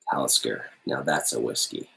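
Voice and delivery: low, smooth ad announcer voice; commercial voice. Acted. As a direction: read it like a whiskey commercial. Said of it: "I read that with a commercial voice".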